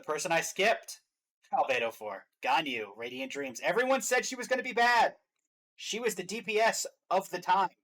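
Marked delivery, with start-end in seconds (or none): 3.6-7.7 raised pitch, loud and emphatic; excited hype. Real, not acted. Fan defending Ganyu to co-host, excited hype. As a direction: champion your favorite loudly and high. Hyping a favorite character in a tier list.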